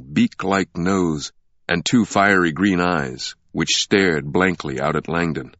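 usual speaking voice

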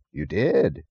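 plain reading voice

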